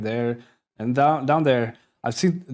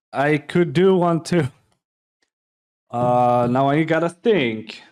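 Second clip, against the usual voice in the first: normal talking voice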